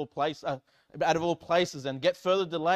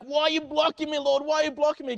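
complaining; 10 semitones higher, 5 dB louder than usual. Retold or imitated. Mock complaint to the Lord.

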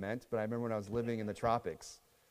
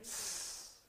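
mock hiss; 15 dB quieter than usual.

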